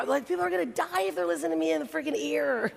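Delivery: high, laughing self-mockery; self-mocking laughter about her voice; laughing at herself. Real, not acted. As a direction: giggle through the joke about her voice. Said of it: "my voice changes a lot".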